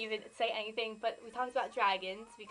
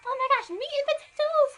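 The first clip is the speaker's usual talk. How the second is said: high squealing fangirl voice, louder by the end; mock gushing fangirl; 8 dB louder than usual. Vlogger mocking fans who ignore Kirsty, squealing high fangirl voice. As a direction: gush like a starstruck fan over the boys. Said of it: "Everyone's just like".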